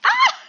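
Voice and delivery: sudden very high, loud yelp; startled scream. Real, not acted. Gamer caught off guard mid-match, startled scream. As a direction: shriek in real surprise.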